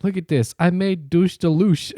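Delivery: high-pitched mimicry of a proud home cook, slowing on the dish name; clueless girl online. Girl who's never heard Spanish shows her dish, mock-proud. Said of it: "never heard the word Spanish".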